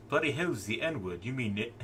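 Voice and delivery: puzzled, put-on Cockney voice; mock Cockney. British comic character asks what the term means, mock Cockney. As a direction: play a baffled Cockney. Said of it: "word it in the Cockney accent".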